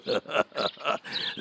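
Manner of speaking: scornful airy laugh; mean breathy chuckle; mocking breathy laugh. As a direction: laugh at the prisoner. Guard mocks the captive.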